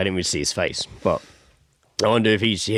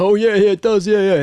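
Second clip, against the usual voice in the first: loud, higher mock agreement; imitated laughing mate; 10 semitones higher, 6 dB louder than usual. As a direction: act out his mate eagerly chiming in. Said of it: "laughed and was like".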